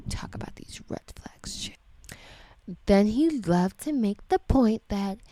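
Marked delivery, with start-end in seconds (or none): 0.1-1.8 soft unvoiced whisper. Real, not acted. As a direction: mutter it under your breath. Whispered aside.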